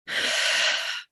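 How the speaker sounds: unvoiced sigh out through the mouth; long audible exhale. Shown as a demonstration. Guide showing how to exhale through the mouth, audible sigh. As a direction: let all the air out in one sigh.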